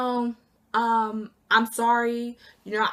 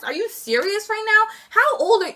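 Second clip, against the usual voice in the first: incredulous; 9 semitones higher than usual. Real, not acted. Incredulous at coworker's absence.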